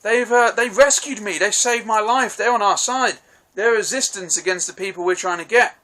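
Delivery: a light mock of the rescued man's gushing; high eager voice. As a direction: plead the case, high and eager. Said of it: "they're like".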